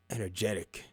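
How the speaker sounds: sarcastic under-the-breath repeat; deadpan quiet echo. Real, not acted. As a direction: drop it flat, almost to yourself. Repeats word after pause, deadpan.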